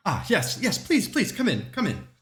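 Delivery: a lightly voiced, eager maid; maid's voice.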